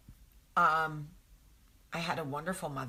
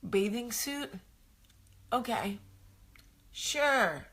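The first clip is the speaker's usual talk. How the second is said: shocked disbelief; 6 semitones higher, slower than usual. Retold or imitated. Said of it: "I was like".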